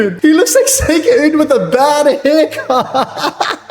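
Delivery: high, slow words breaking into laughter; laughing hard. Real, not acted. Streamer mocking a bad haircut, cracking up. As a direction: crack up while talking.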